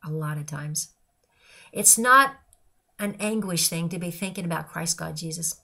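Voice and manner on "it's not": "not" hit hard and much louder; stress on NOT. Real, not acted. Telling listeners faith is no burden, loud stress on "not". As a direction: slam the word "not" like a preacher. Emphatic denial in preaching.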